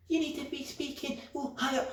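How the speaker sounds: put-on high, squeaky professional voice; high-pitched office voice. Man mocking feminized workplace speech, high-pitched. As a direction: mimic a soft, very high corporate voice. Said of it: "can't be talking with no low tone, deep voice".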